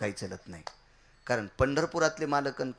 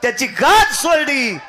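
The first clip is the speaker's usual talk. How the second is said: high, shouted chant-like proclamation; full-force preaching; 11 semitones higher, 15 dB louder, slower than usual. Real, not acted. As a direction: cry the verse out with drawn-out force. Climax of sermon after sung verse. (पूर्ण जोशात, ताणून, उंच आवाजात कीर्तन)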